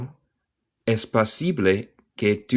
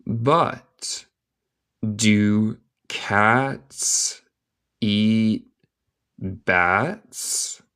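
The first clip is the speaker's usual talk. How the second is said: very slow question with long gaps; word-by-word dictation; much slower than usual. Shown as a demonstration. Teacher reading a question to learners, word by word. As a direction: separate each word, rising at the end.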